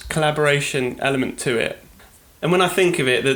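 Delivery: normal talking voice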